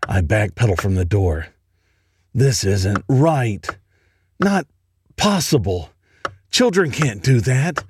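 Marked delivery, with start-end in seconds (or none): none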